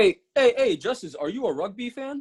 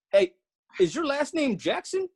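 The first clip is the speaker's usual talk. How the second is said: ordinary talk, nothing marked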